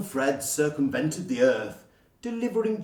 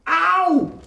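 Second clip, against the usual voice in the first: high, loud yelp of pain; scream of pain; 9 dB louder than usual. Acted. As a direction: yelp as the spell hits. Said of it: "screamed Joe".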